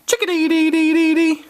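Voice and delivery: high, fast chirpy birdsong imitation; playful high chickadee call for the child. Shown as a demonstration. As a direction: whistle it like a little chickadee. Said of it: "I'll do the sound".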